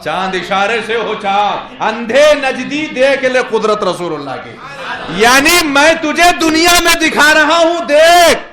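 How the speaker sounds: high, very loud sermon delivery; impassioned high-pitched shouted sermon; full-force preaching. Acted. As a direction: preach it at the top of your voice.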